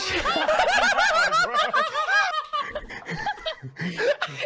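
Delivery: high, breathless shriek of laughter; long shrieking laugh. Real, not acted. Friend retelling the badge story, shrieking with laughter. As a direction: lose it completely, squealing high. Friends recalling a funny moment.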